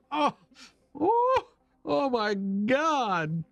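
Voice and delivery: high drawn-out moaning oh my God, hammed up; mock ecstatic moan. Streamer doing a joke scream on request, high mock moaning. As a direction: ham up a squealing fake moan. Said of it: "scream like I'm in heat".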